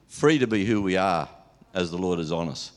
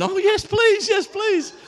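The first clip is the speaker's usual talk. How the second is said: much higher, louder excited squeal; eager squeal; 5 dB louder, slower than usual. Retold or imitated. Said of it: "And so she's going".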